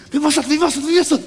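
a caller asking over and over who that was; a hint of a confused lady; mock confused caller. Mother on phone, repeated question.

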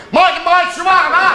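high, loud repeated order; pestering customer. Acted. Orders shawarma yet again.

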